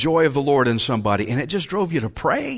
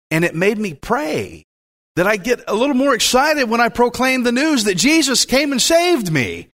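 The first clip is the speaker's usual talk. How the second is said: ordinary talk, nothing marked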